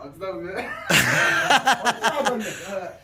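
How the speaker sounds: laughing fit lasting a few seconds; long laugh. Real, not acted. Player amused by the challenge attempt, long laugh. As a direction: crack up and keep laughing.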